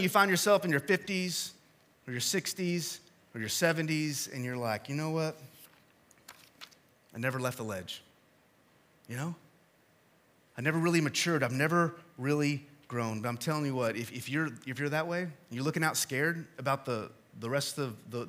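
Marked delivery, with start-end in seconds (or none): 4.9-13.2 low, soft admission with long pauses; subdued confession. Acted. Older believer admitting they never left the ledge, subdued confession. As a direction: confess it quietly, letting each pause sit.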